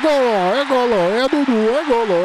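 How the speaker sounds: high, loud goal yells; explosive goal commentary. Acted. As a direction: scream each goal call.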